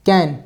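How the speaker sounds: कैन with a short a vowel; can, short vowel. Shown as a demonstration. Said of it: "तो ये हो जाएगा केन".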